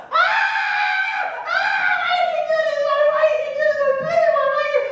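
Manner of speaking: very high, loud screaming with laughter in it; panicked falling scream. Acted. Baby eagle falling from mama's back, screaming very high. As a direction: scream as you drop through the sky.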